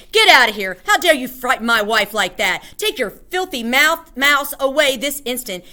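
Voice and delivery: loud, furious ordering; outraged husband at full voice; shouting. A narrator's reading. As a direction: shout them out of the room. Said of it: "towering over us and shouting".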